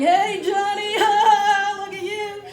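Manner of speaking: high, sing-song teasing holler; imitating teammates hooting at a friend; mock calling out. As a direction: heckle a runner from the practice field. Football team calls out teasingly.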